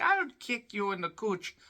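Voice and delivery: a hint of a cheeky leprechaun. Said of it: "Why they're talking like a leprechaun".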